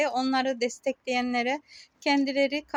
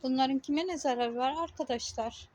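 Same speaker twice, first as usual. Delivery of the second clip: ordinary talk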